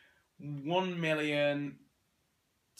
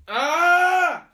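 sudden yelp of pain; pain shout; 18 dB louder than usual. Real, not acted. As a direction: cry out as the peg bites. Pegs clipped on, sudden pain.

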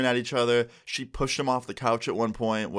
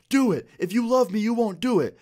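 high, loud mock challenge; acted high-pitched defiance; imitated defiant dare; 10 semitones higher, 4 dB louder than usual. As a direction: goad her in a pitched-up voice. Retold friend daring his girlfriend.

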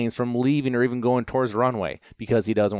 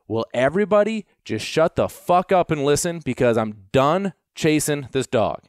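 pitched-up angry outburst at the crowd, fed up; snapping angrily; 6 semitones higher, 3 dB louder than usual. Retold or imitated. Fed up with the chaos, he snaps at the helpers, angry outburst. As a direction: finally snap and make everyone shut up. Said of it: "I snapped a little".

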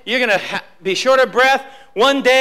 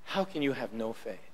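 much lower and much softer, tender rebuke; a quiet, sorrowful question from Jesus; gentle rebuke; 13 semitones lower, 18 dB quieter than usual. Acted. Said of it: "And Jesus would say".

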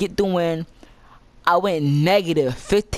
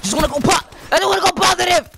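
high, building frustrated yell; desperate shouting; 13 semitones higher, 4 dB louder, faster than usual. Real, not acted. Gamer desperate for a positive score, frustrated shouting. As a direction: plead at the top of your voice. Desperate escalating plea in game.

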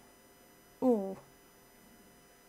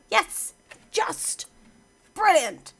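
high, punchy posh English exclamations; going posh; 7 semitones higher, 4 dB louder, much slower than usual. Pretend. Player cheering a narrow success, mock posh. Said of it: "I don't know why I'm going posh".

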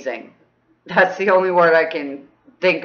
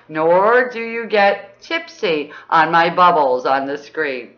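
usual speaking voice

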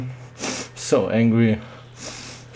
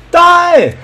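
high, loud shout; battle yell; 10 dB louder than usual. Real, not acted. Gamer firing on an opponent, battle yell. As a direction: yell it at the enemy. Yelling at an enemy.

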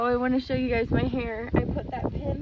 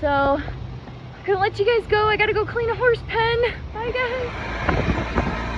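bubbly sign-off; 9 semitones higher, 4 dB louder than usual. Real, not acted. Cheerful sign-off to viewers.

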